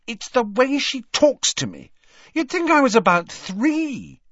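put-on high, aggrieved girl's voice; annoyed teen girl. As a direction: complain like a fed-up teenage girl. Ginny complaining, higher voice.

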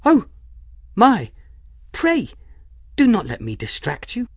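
put-on a young woman caught off guard; flustered young Folly. A narrator's reading. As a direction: gasp it out, startled and over-polite. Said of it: "Folly said".

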